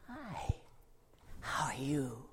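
soft whispered greeting; gentle whisper. Acted. Jesus greeting the little boy, softly whispered. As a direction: greet a shy child in a whisper.

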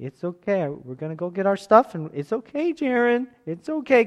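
put-on high kid voice soothing his brother; a little boy reassuring his crying brother; big brother comforting. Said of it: "he's like".

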